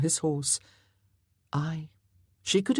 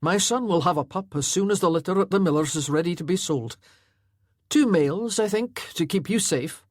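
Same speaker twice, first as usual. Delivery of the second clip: fast, louder laird's voice; 3 semitones higher, 4 dB louder than usual. Acted. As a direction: promise the boy briskly. Laird promises son a pup.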